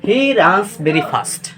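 "He runs very fast" read very slowly, word by word; teacher reading the example slowly. Shown as a demonstration. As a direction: dictate the sentence for copying. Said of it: "এখানে বলা হয়েছে".

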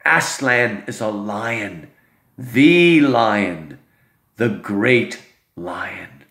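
amazed they don't know, then hushed awe; Mister Beaver. Acted. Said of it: "Mister Beaver says".

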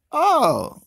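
high, loud oh; surprised shout. Real, not acted. Player seeing how the others will play, loud surprised oh. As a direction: caught out by the rivals' trick.

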